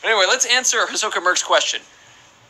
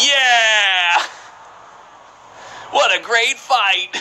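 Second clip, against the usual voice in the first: excited cheer; 4 semitones higher, much slower than usual. Real, not acted. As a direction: cheer it out, raised and stretched. Cheering on a fight in game.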